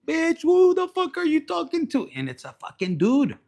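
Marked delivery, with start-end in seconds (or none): 0.1-2.1 much higher shrill-free angry outburst; mock jealous yell. Jealous partner grabbing the rival, much higher mock angry outburst. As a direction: confront the rival in a pretend fury.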